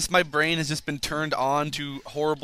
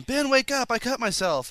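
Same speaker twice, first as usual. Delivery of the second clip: higher urgent plea, lightly voiced; urgent wake-up call; 8 semitones higher than usual. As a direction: call out to wake him, alarmed. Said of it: "I heard Megan going".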